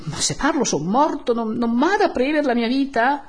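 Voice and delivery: high, loud, anxious pleading; frightened protest. Acted. Don Abbondio fearing death if he talks, frightened protest. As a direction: plead for your life in alarm. Priest fears for his life.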